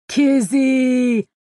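loud, drawn-out jubilant holler; jubilant boom. A narrator's reading. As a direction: boom the name out joyfully. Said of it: "boomed, his voice jubilant".

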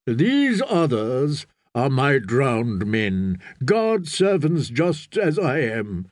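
slow, deliberate priestly declaration; solemn prophet. Acted. Priest defends his drowned men, solemn and slow. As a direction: stretch each phrase with firm conviction.

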